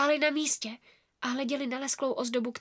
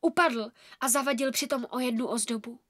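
usual speaking voice

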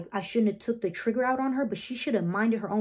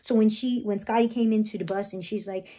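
ordinary talk, nothing marked

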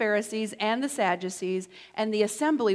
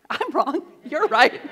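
higher and louder, laughing through it; laughing apology; 4 semitones higher, 6 dB louder than usual. Pretend. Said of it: "that's how we want to apologize".

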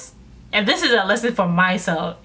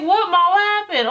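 imitating her nosy excited self; 9 semitones higher than usual. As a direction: leap on the gossip, wide-eyed. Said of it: "All geeked, like, I'm nosy".

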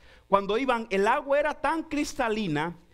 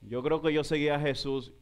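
mild mock dismay, low; 8 semitones lower than usual.

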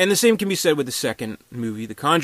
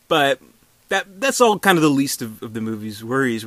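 normal talking voice

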